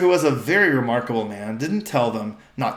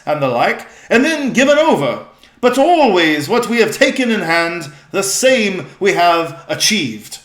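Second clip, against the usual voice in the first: loud, higher, proud high king; regal old-fashioned speech; 7 semitones higher, 9 dB louder than usual. Acted. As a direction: declaim the royal oath with pride. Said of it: "their voice has changed".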